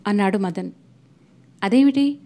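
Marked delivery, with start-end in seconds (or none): 1.6-2.2 short bewildered ask, pitched higher; puzzled question. A narrator's reading. Chalam asks Madan, not understanding, puzzled and higher. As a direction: ask it as if the news makes no sense. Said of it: "అర్థం కానట్లు అడిగాడు".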